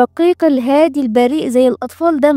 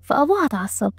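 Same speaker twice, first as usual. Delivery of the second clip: ordinary delivery, nothing marked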